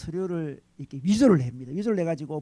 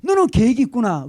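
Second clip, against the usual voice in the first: admiring quote; 4 semitones higher, 6 dB louder than usual. Retold or imitated. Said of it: "감탄을 하죠".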